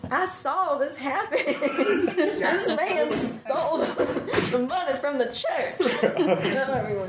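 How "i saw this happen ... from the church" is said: high, slow mock Texas drawl; Texas accent. Tourist telling the cop about a theft, mock Texas accent. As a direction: put on a playful Texas accent, half laughing. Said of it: "let's hear your Texas accent".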